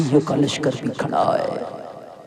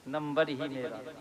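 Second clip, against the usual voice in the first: soft savouring echo; 13 dB quieter than usual. Shown as a demonstration. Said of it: "हाय, हाय".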